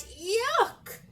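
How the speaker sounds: high-pitched cry of disgust; storybook dragon's yelp of yuck. A narrator's reading. Dragon lands in the gloop, crying out. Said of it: "and cried".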